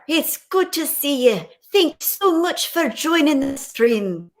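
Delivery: mock Scottish accent for greeting a viewer; playful fake Scottish accent; bad Scottish accent. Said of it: "I'm gonna do a bad accent".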